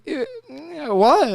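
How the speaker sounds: high drawn-out mock pout; mock pitiful wife. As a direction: drag it out like the hurt wife. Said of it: "She's like".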